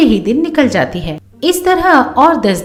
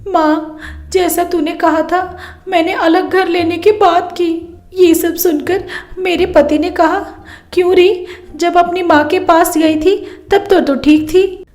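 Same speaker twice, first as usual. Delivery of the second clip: higher, tearful daughter telling her mother; tearful complaint; 6 semitones higher than usual. Acted. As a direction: pour out the hurt through tears. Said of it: "रोते-रोते बोलती है". (रोते-रोते शिकायत)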